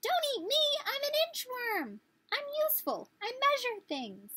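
high, pleading little inchworm; squeaky inchworm voice. Acted. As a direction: beg for your life in a tiny high voice. Inchworm begs robin not to eat him.

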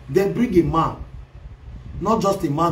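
normal talking voice

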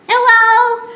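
high squeaky pig puppet hello; piglet voice. Pretend. Puppet pig greeting the children, squeaky.

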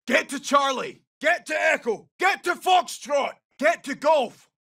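high-pitched, urgent callout; urgent military shout; urgent squad order. Acted. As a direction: call out the objective to the squad, voice pushed high. Urgent squad order in game voice lines.

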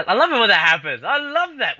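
loud, fast and thrilled; excited. Real, not acted. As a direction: burst out with real excitement. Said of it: "You sound so excited".

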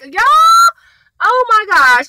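high, loud excited squeal. Real, not acted. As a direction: gush loudly about Jake. Excited about Jake's scene.

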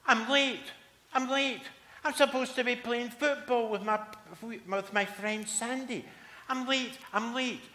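put-on high, loud panicky kid; a boy in a flap about being late; squeaky frantic child; panicked boy.

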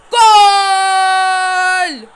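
loud, high goal yell then laughing; goal shout with laugh. Real, not acted. Kid scoring again, shouting goal then laughing.

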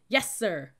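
loud recruit's reply; shouted response. A narrator's reading. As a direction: answer smartly at full voice. Recruits yelling reply in unison.

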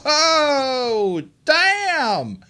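high, loud drawn-out wail; long, loud cry of dismay; shocked outcry. Real, not acted. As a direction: howl it as it all goes wrong. Character gets knocked down.

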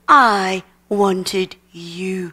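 very slow, low, spaced words; lingering, deliberate reassurance; tender divine assurance. Retold or imitated. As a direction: speak God's love slowly and low. Retold words of God to daughter.

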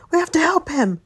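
high, urgent plea; alarmed. Acted. Friends hearing Chris get hurt, high and alarmed. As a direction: cry it out in alarm.

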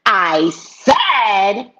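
loud, drawn-out imitation of an angry clerk; yelling at a customer. Store clerk pulling down the mask to yell at a customer. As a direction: imitate her yelling, stretching each word. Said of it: "she begins to yell at me".

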